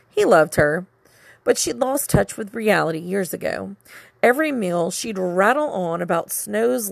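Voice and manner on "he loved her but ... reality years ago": ordinary delivery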